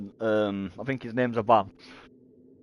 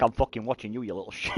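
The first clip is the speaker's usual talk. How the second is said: mock menace; 3 semitones lower, 7 dB quieter than usual. Dad voicing protective dads' menace.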